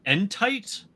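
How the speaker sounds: Entite said as en-tight, rhyming with bite; Entite pronounced. Shown as a demonstration. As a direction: say Entite the way it's spelled, en-TITE. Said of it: "'Cause it's spelled E N T I T E".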